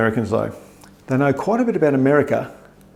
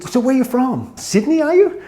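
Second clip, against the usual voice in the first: high-pitched mock American questioner; curious American; 12 semitones higher, slower than usual. American asking the Aussie where he's from, high curious mimicry. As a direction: pitch it up, eager to know.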